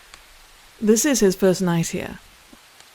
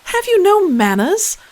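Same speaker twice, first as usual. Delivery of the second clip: scolding; 7 semitones higher, 6 dB louder than usual. Acted. Princess scolds shouting prince.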